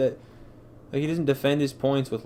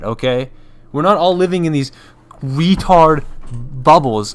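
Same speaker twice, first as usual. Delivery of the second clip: much louder angry yelling; much louder furious rant; 10 dB louder than usual. Real, not acted. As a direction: tear into people who are offended. Furious rant defending F-bomb.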